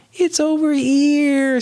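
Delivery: high, drawn-out cartoon character voice; playful high-pitched character call; Figment. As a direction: sing it out high, stretching every word. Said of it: "says Figment".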